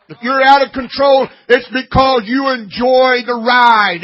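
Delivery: loud, driving preacher's voice; fiery sermon delivery; full-force preaching. Acted. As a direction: hammer it home from the pulpit.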